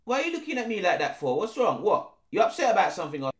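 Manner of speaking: quick, cocky street-slang voice; a fast, lightly voiced mouthy roadman. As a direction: rattle it off like a London roadman.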